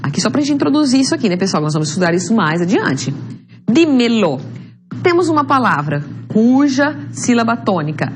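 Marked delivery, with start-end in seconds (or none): 3.7-4.4 "Dímelo" twice, strong first Dí, falling away; stress on DI. Shown as a demonstration. Teacher demonstrating an esdrújula to students, stressing Dí in "Dímelo". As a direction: hit the Dí of "Dímelo" each time. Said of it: "é di".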